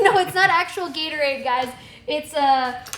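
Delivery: ordinary talk, nothing marked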